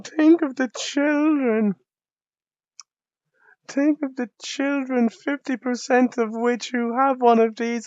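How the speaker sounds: mock a horrified prude, high and loud; scandalized prude. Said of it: "that'd be like".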